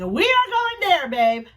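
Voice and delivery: high, loud, drawn-out excited call; excited announcement. Retold or imitated. Woman to her babe on where they're going, excited. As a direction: announce it eagerly, stretching the words.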